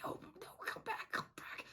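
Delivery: hushed, urgent whisper; whisper-plead from the window; mock frantic whisper.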